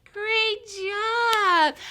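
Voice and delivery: high, loud cheer; big delighted whoop of praise for toddlers; excited praise. Real, not acted. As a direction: cheer the little singers on. Cheering children after the song.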